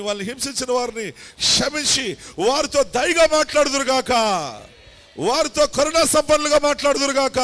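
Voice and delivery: loud, high, impassioned intercession; fervent prayer. Acted. Pastor praying over the live audience, loud and fervent. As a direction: cry out to God with full force.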